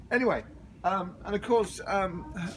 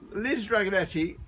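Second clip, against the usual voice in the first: ordinary delivery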